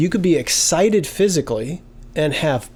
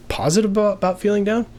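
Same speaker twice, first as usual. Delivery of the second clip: higher, doubting echo of the idea; puzzled; 7 semitones higher, faster than usual. Retold or imitated. Student puzzled by being positive about feeling down, higher and doubting. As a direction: echo it back as if it makes no sense. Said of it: "And you're thinking".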